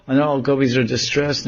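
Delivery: normal talking voice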